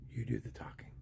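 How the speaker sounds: hushed aside to a friend; whispered aside. Retold or imitated. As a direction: whisper it behind your hand. Friend whispering instructions to him.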